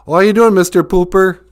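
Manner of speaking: a cheerful, goofy big bird greeting. Retold or imitated. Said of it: "He'd be like".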